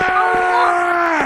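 real startled shouting at zombies; alarmed shout. Real, not acted. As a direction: shout them off in a panic.

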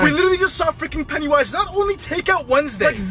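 high, loud, worked-up recap; agitated excitement. Real, not acted. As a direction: blurt it out in amazement. Excited recap while getting away.

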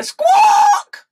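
high, loud squawk; shrill, very high parrot call. Shown as a demonstration. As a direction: show the kids a parrot's cry. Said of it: "Can you make the sound of a parrot?".

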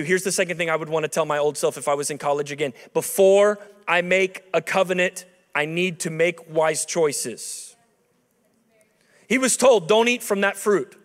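ordinary delivery

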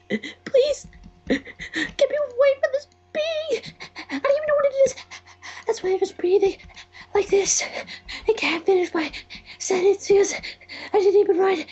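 high, hammed-up terrified squeal; bad acting. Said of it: "you can sound like the lady from the Blair Witch Project".

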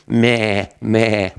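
a light mock goat bleat, meh. Teacher bleating to make kids guess goat, a mild mock goat bleat.

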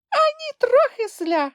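extremely high squeaky voice; high-pitched little creature reply; tiny creature's answer. A narrator's reading. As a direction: pipe back in a tiny squeak. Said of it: "відповіла Трясля".

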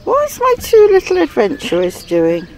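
high doting voice for dogs; pet talk. Real, not acted. Repeats question to her dogs, higher.

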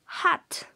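"Hat" with a strong breathy h like Spanish J. Shown as a demonstration. Teacher showing the h sound of "Hat". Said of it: "la H ... corresponde a una J".

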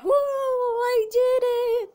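high, drawn-out whoop of triumph; excited cheer. Real, not acted. Celebrates a success in the game.

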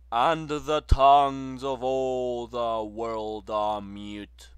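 loud, ringing recitation of the soldier's song; booming verse recital; loud declamation. A narrator's reading. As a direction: proclaim it across a field.

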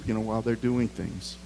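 usual speaking voice

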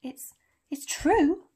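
slow, halting, high disbelieving question; faltering. A narrator's reading. McGonagall asks Dumbledore to confirm the rumour, faltering. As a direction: stumble into a shocked question. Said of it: "faltered Professor McGonagall".

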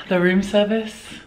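normal talking voice

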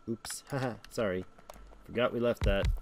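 sheepish laugh into an apology; nervous laugh. Real, not acted. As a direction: laugh it off, caught out. Oops, forgot we left that.

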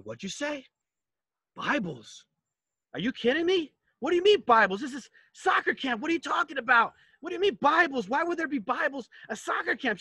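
stunned outrage building up; incredulous. Retold or imitated. Shocked at Bibles at camp.

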